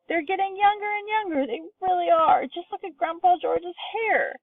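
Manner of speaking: high, thrilled and breathless; old man marvelling aloud; excited yelling. A narrator's reading. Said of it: "Grandpa Joe was yelling".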